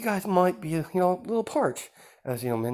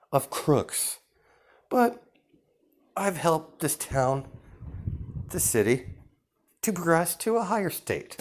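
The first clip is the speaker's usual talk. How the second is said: smooth villain host; 5 semitones higher than usual. Acted. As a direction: greet the guests with false charm, pitched up. Villain boasts about town.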